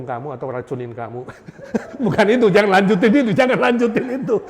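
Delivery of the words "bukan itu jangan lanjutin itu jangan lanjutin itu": raised, loud laughing voice; laughing protest. Real, not acted. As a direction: wave it off through laughter. Laughing at own joke.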